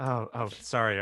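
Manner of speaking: ordinary talk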